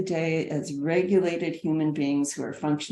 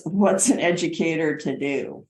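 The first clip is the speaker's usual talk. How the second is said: normal talking voice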